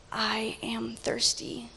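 slow, hushed, near-whispered confession; soft and drawn out; suffering Jesus. Acted. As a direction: let it sink almost into a whisper. Preacher voices Jesus on the cross.

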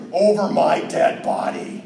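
put-on slow, raspy scowling old man. Stubborn uncle refusing the DJ's invitation to dance, scowling old man. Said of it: "old man scowl that's like".

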